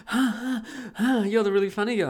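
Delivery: high, loud imitation of an amused fan; mock eager greeting. Imitating party guests greeting the funny guy, high and eager.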